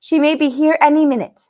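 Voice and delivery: lightly voiced worried girl; Susan anxious. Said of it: "said Susan".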